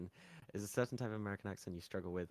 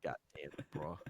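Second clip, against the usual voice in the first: Texan accent; 3 semitones lower than usual. Shown as a demonstration. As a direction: do a quick Texan twang. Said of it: "I don't know if this is Texan".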